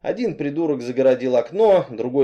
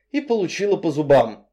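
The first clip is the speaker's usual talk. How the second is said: usual speaking voice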